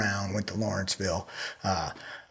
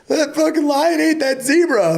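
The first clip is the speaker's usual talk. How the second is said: much higher, louder whiny outrage; mocking squeal; 5 dB louder than usual. Mocking people upset by a lion kill in a squealing voice. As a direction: parody a squeamish nature-show viewer. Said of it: "Those people crack me up when they're like".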